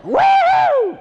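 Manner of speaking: put-on rowdy Tennessee accent cheering. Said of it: "It's my Tennessee voice".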